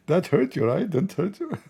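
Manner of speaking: high, giggling through the jab; laughing taunt. Retold or imitated. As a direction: taunt while cracking up. Retelling Trump taunt, laughing.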